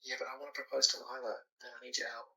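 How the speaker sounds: hushed whisper, barely voiced. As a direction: whisper so no one wakes. Late night call, others asleep.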